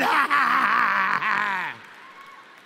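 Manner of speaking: big laugh over the crowd; loud triumphant laugh. Real, not acted. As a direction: laugh out the win. Laughs after punchline.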